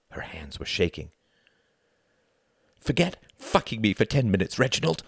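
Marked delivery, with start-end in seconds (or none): 2.8-5.0 higher, cutting anger; hard, cold reproach; sharp and icy. A narrator's reading. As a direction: throw it like bricks of ice. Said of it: "her voice like sharp bricks of ice".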